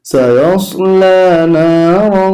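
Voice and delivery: slow, melodic tilawat; drawn-out, sung Quranic recitation. Shown as a demonstration. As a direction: chant the surah slowly and devoutly. Full surah recitation.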